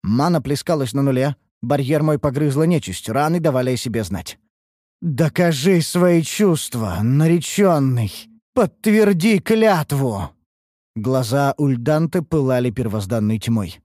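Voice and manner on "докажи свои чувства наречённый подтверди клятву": slow, eerie possessed voice; eldritch horror. Acted. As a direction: drag each word out, otherworldly. Possessed elf demands oath.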